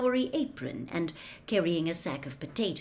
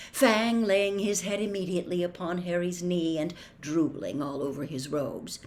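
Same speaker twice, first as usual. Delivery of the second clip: usual speaking voice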